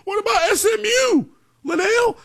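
high-pitched mock fan protest; whiny-high imitation of a doubter; mock objector. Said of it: "some people are gonna say".

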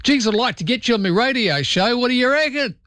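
broad Australian caricature, pitched high; exaggerated Australian radio caller; hammed-up Aussie caller. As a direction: lay on the Aussie slang and twang thickly. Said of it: "make up a name".

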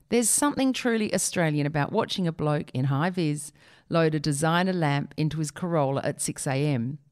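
usual speaking voice

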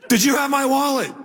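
high-pitched mock interrogation; accusing question. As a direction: question your daughter in acted alarm. Blames daughter for missing wallet.

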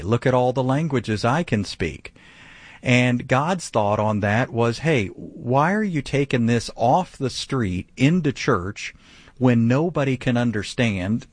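ordinary talk

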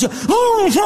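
very high-pitched howl of despair; frantic mock wail. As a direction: howl like a father losing his mind. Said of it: "Don González se puso loco". (alarido exagerado de desesperación)

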